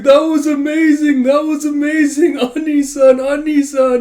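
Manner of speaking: very high, loud blubbering praise; hammed-up joyful wailing. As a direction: gush at full volume like overcome juniors. Sobbing pupils praise their senior.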